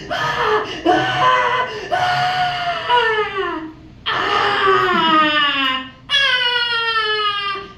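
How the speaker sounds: put-on high wailing shrieks, kid losing it; imitated child screaming. Mimicking the toddler screaming behind her on the plane. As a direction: scream like a toddler having a meltdown. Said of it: "screaming like he lost his mind".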